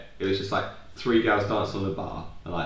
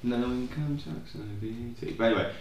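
ordinary delivery

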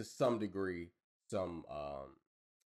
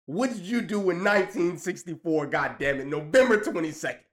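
tough interrogator; 7 semitones higher, 8 dB louder than usual. Pretend. Mock grilling Wolverine for facts.

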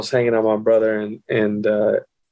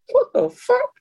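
extremely high squeaked reaction; squeaky retold bewilderment; stunned disbelief. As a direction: send the pitch through the roof in mock shock. Retelling shock at brother's explanation.

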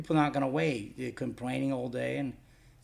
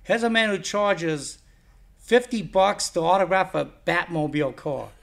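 loud, higher-pitched disbelief; retold incredulous rebuttal; 4 semitones higher, 7 dB louder than usual. Label owner answering a fan wanting Adam West free, incredulous. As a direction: push it out emphatically, as if schooling someone.

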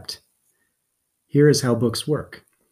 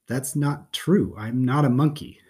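ordinary delivery

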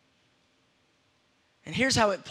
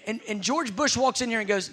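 ordinary talk, nothing marked

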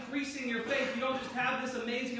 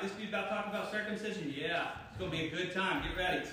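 soft, fast sarcastic aside; 5 semitones lower, 4 dB quieter than usual. Acted. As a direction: mutter sarcastic doubt to yourself. Acted listener sarcasm.

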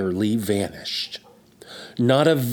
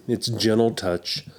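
usual speaking voice

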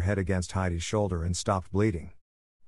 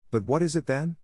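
mildly eager, higher-pitched question; 7 semitones higher than usual. A narrator's reading. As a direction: ask with eager curiosity, voice lifted. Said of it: "asked Heidi eagerly".

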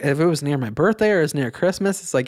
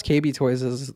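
ordinary talk, nothing marked

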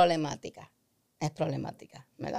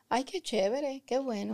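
flat, lukewarm "Ay, qué chévere", softer; unexcited thanks; 3 semitones higher, 7 dB quieter than usual. Retold or imitated. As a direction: say it with no enthusiasm at all. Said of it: "hay personas que dicen".

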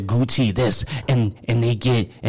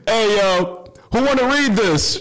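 teacher calling out; 9 semitones higher than usual. Retold or imitated. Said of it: "it was time to read in class".

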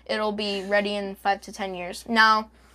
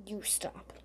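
hissed whisper; 3 semitones lower, 20 dB quieter than usual. Real, not acted. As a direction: whisper it back sharply. Hushed retort during quarrel.

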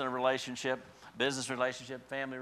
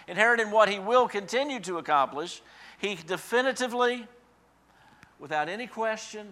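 preaching crescendo; 8 semitones higher, 7 dB louder than usual. Acted. Preacher building a litany to the congregation, then resting. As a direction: build the repetition, then settle.

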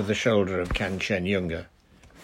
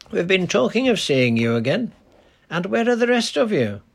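put-on kindly old Lakeland countryman, a bit higher; easy, friendly old dialect voice; lightly voiced old charcoal burner greeting a girl; 10 semitones higher, 4 dB louder than usual.

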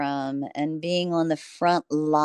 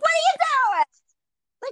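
high, drawn-out yell at traffic; stretched, high-pitched holler; retold street yell; 4 dB louder, slower than usual. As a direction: re-enact yourself hollering at drivers, pitched way up. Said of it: "the person who's screaming".

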